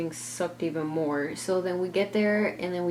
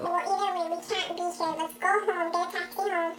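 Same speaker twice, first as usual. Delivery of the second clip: much higher put-on mom voice; mimicking mom; 12 semitones higher than usual. Narrator imitates her mom asking the driver, high-pitched.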